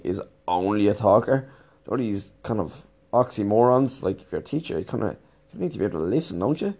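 ordinary talk

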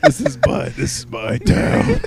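grumpy local voice. Pretend. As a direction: claim the town like a cranky local. Playing a territorial local.

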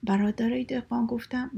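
ordinary delivery, nothing marked

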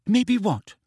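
high, impatient query; rather snappish. A narrator's reading. Hermione demanding Ron explain, rather snappishly. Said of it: "said Hermione rather snappishly".

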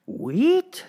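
very high rising question; can't believe the word; lady stunned by his gift; shocked disbelief. Acted. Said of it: "said the lady".

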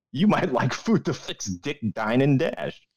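normal talking voice

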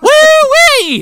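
high, loud hammed-up whoop; whooping wrangler. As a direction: whoop in mock triumph. Acting out Irwin grabbing the animal.

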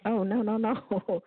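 usual speaking voice

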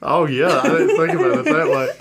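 high, laughing realization. Real, not acted.

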